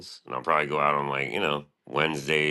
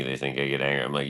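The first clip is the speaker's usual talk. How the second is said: normal talking voice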